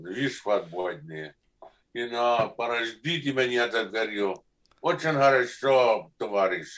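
practising the Russian lines aloud. Shown as a demonstration. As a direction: recite the Russian lines like a learner drilling them. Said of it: "I practiced my Russian".

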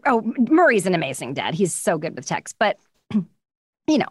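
usual speaking voice

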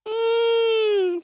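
high, loud wordless moan; whale wail. A narrator's reading. Whale disbelieved by the eagle, high loud wail. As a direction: wail in protest.

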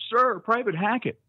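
high, loud sir-private shout; recruit sound-off. Retold or imitated. Recruit sounding off to the drill instructor, loud and high. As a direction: snap off your name like a recruit. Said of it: "So I go".

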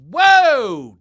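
loud sudden whoa of surprise; surprised whoa. Real, not acted. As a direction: blurt it out, caught off guard. Surprised at bank collapse.

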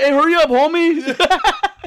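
high, loud, drawn-out mock holler; impatient calling out. Homie hurrying up the guy in line, impatient calling out. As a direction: holler it slowly like a waiting homie.

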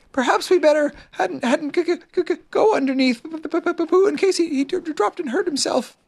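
high, stuttering little Piglet; frightened stammer. A narrator's reading. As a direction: stumble nervously on the first sounds, pitched high. Piglet nervously urges caution.

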